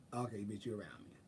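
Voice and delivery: barely voiced, very hushed; near whisper. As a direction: drop almost to a whisper, low. Repeats it, feeling a chill.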